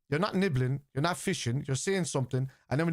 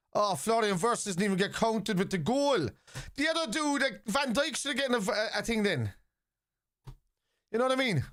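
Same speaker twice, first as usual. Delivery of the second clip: indignant; 8 semitones higher than usual. Real, not acted. Reacting to goal decision.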